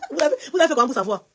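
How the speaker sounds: incredulous laughing question; cracking up in disbelief; laughing amazement. Real, not acted. Laughing amazement at listeners' discovery.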